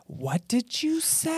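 high, slow ghost voice; ghostly whisper. Shown as a demonstration. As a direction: breathe it out like a faint ghost.

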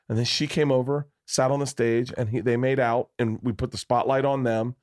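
usual speaking voice

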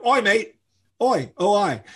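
high-pitched mock Cockney hail; Cockney attention call. Shown as a demonstration. Shows how Cockneys get your attention, Cockney hailing. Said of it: "that's how Cockneys get your attention".

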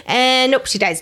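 ordinary delivery

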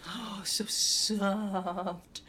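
cooing. Acted. Cooing over the pillow.

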